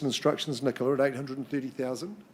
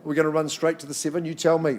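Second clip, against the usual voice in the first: ordinary talk, nothing marked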